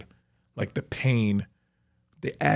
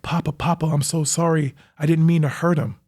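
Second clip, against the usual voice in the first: higher, pleading, apologetic voice; pleading killer on the phone; 5 semitones higher, 4 dB louder than usual. Retold or imitated. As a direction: beg forgiveness in a raised, tense voice. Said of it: "this person on the phone's like".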